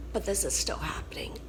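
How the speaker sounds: a drained whisper of frustration; defeated whisper. Real, not acted. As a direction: whisper it, worn down. Frustrated by illness.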